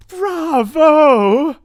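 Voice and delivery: very loud, high cheer; the witches cheering their leader; crowd's yell. A narrator's reading. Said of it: "yelled the audience".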